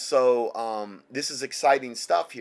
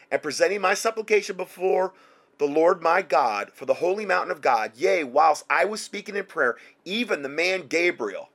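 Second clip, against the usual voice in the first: earnest bible recital, a bit higher; scripture reading; 4 semitones higher, 4 dB louder than usual. Acted. Preacher reading Daniel aloud to listeners, earnest. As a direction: read the verse with reverence.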